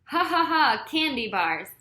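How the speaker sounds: loud spoken laughter then the words; mock laughing roll call of candy. A narrator's reading. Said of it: "the laughter beginning again".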